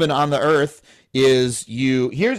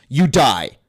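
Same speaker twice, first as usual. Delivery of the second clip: ordinary delivery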